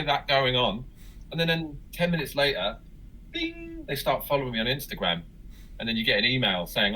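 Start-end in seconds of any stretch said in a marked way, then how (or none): 3.3-3.8 high, soft sung-out "bing"; one held high note; imitated alert chime. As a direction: mimic a phone notification ping.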